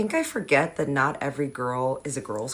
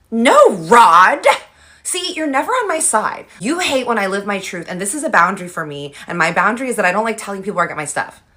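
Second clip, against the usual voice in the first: put-on high, louder whiny protest at her boyfriend; whiny girlfriend; 3 semitones higher, 7 dB louder than usual. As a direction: snap back at Rod, then rush the boundary speech. Girl bickering with boyfriend Rod.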